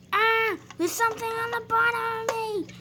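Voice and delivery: high, loud frightened shriek; panicked scream. Acted. Engine floating, frightened.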